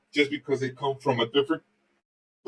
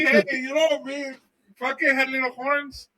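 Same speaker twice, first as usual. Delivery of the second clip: mock witness voice; 9 semitones higher than usual. Retelling the witness claim.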